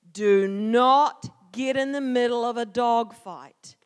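loud, slow, emphatic rule; dad's firm warning. Retold or imitated. Dad always warned his kid, firm warning. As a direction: lay down the rule slowly and forcefully.

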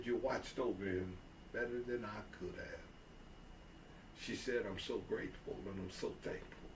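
a mother thanking Sarah, hushed and grateful; quiet grateful. Acted. Mother's tearful gratitude.

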